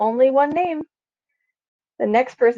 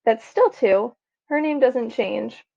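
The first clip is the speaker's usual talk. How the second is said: ordinary delivery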